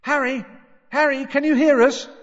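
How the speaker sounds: high, loud shout through a barrier; calling out. A narrator's reading. Trapped family calling to Harry through boards, loud and high. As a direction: shout to be heard behind boards.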